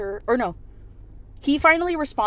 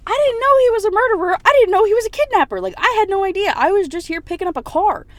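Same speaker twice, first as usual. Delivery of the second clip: loud, very high-pitched defensive outburst; acted wail of self-defence, pitched way up; frantic protest; 12 semitones higher, 10 dB louder than usual. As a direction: protest innocence in a shrieky panic.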